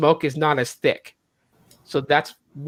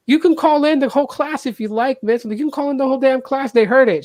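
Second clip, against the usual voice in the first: much higher, heated insisting to the VP; indignant protest; 8 semitones higher than usual. Retold or imitated. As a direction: push back hard, voice up high. Arguing with the VP.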